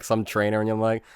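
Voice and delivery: usual speaking voice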